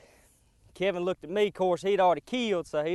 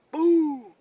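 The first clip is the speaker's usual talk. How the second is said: mock gunshot boom; 9 semitones higher, 7 dB louder than usual. Imitating the rifle shot.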